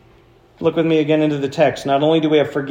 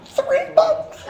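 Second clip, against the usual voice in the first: much higher, laughing through it; laughing disbelief. Real, not acted. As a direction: laugh at the lost chance. Laughing at lost bucks.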